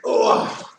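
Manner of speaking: loud strained "ugh"; effortful groan. Real, not acted. Instructor shifting position before class, effortful groan.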